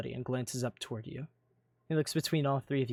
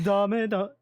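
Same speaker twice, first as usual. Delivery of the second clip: mock exclamation; 7 semitones higher, 9 dB louder than usual. Exclaims in Japanese.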